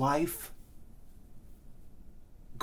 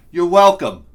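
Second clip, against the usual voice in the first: you're welcome belted, much louder; loud boast; 13 dB louder than usual. Acted. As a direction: proclaim it like a hero. Proud boast after coffee run.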